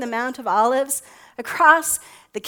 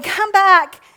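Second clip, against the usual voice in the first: calling out; 6 semitones higher, 8 dB louder than usual. A narrator's reading. Mother calling her running child.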